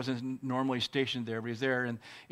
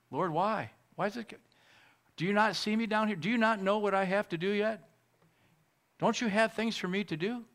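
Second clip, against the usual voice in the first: high, pleading cry to the Lord; pleading with God; 9 semitones higher than usual. Retold or imitated. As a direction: beg God why, voice lifted high. Pastor retells crying out to God.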